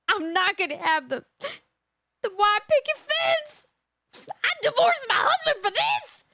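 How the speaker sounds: high, whiny mocking voice of the crying accomplice; mock whiny woman. Vlogger mocks the crying accomplice in a whiny voice. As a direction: pitch up high and whine it like her. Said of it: "she's crying because she's like".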